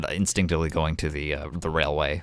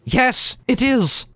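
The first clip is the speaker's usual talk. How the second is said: high, louder emphatic agreement; higher and louder, eager yes; Idris agreeing; 5 dB louder, much slower than usual. Acted. Idris answering the friend's question, high and emphatic. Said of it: "Idris says".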